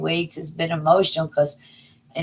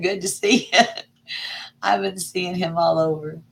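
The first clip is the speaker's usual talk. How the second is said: ordinary delivery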